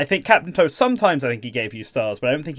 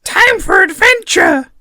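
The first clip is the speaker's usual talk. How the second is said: very high, loud slow shouted catchphrase; squeaky loud cartoon shout; impression; 9 dB louder, slower than usual. Shown as a demonstration. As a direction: belt it out like a cartoon hero. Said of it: "can we all give our best Time for Adventure".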